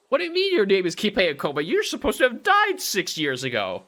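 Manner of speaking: shocked. Real, not acted. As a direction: blurt it out in total disbelief. Player shocked at the name.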